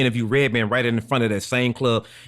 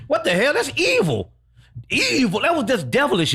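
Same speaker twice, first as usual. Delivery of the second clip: high-pitched, appalled protest; scandalized high exclamation; indignant outburst; 10 semitones higher than usual. Real, not acted. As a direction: cry out at the injustice, pitch way up. Venting over a ripped poster.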